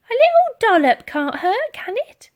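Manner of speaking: put-on high, loud doting old lady; cleaning lady giving in to the girl. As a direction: coax it, giving in.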